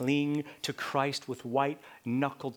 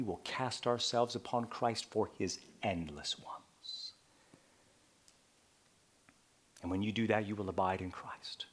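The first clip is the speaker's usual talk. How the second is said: whisper; 7 semitones lower, 8 dB quieter than usual. Real, not acted. Whispered sermon conclusion.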